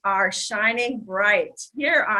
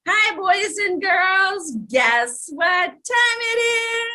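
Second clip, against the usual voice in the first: put-on children's host; 9 semitones higher, 3 dB louder than usual. Children's show host greeting.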